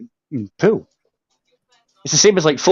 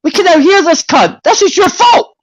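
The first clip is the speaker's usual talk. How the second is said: starts loud and climbs ever higher into a shrieking fake tantrum; mock rage building to a shriek; 12 semitones higher, 5 dB louder than usual. Teasing Uncle JJ for letting everyone hear, a mock rage building to a shriek. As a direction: pretend fury that spirals up to a squeal.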